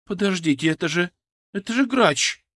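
high, venom-laced recognition; halting, then spit the name out; venomous. A narrator's reading. Woman recognizing the patient, venomous. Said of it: "В её голосе было столько яда".

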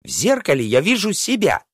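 high, indignant princess retort; storybook princess flaring up again; princess boiling over. A narrator's reading. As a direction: snap back high, as a seething princess. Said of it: "вновь закипела принцесса".

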